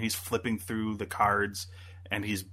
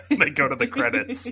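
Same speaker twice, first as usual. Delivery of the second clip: higher-pitched, laughing through the words; 12 semitones higher, 4 dB louder than usual. Real, not acted. Amused by the abrupt ending.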